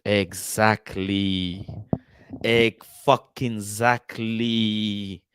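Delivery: very slow, stretched exactly; drawn out. Real, not acted. To his viewers, very slowly stretched exactly. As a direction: drag out exactly with heavy emphasis.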